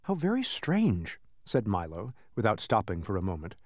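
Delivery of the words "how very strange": higher, slower musing; mildly puzzled. A narrator's reading. Milo musing to himself while digging, mildly puzzled. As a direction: lightly wonder aloud as you work.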